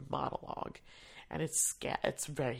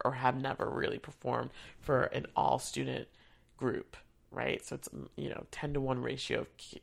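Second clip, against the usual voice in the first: ordinary talk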